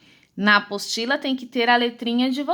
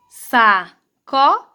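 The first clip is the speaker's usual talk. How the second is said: slow, evenly spaced syllables; sílabas separadas; 4 dB louder, much slower than usual. Shown as a demonstration. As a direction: sound out Sacola as SA-CO-LA. Teacher reads finished word by syllables. (leitura silabada e lenta)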